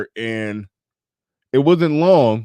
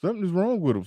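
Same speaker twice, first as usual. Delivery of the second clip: incredulous; 8 semitones higher than usual. Real, not acted. Incredulous laughing comment.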